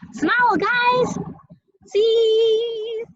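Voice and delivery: high, loud, drawn-out camera call; photo call. Real, not acted. Parent to kids posing for a picture, high photo call.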